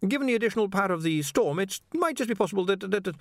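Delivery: high, stammering anxious scholar; a jittery young wizard stammering high; nervous wizard. A narrator's reading. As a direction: stumble over every word, flustered. Ponder trails off.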